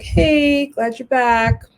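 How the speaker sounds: high, fake cheerful greeting; mock-pleasant hello to the boss you dread; forced cheer. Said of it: "but I was like".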